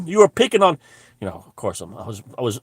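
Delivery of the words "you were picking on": mock outrage. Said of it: "And I said".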